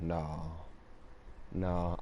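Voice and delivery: low, soft, stunned refusal; heart-dropped dread. Retold or imitated. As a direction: retell a sinking realization, quietly with a long pause. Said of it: "instantly my heart dropped".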